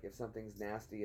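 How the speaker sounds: normal talking voice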